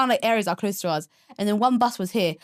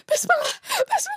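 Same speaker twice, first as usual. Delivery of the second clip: high, crying plea for protection; panicked prayer. Retold or imitated. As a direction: gasp out a frightened prayer on the bike. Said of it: "I'm like".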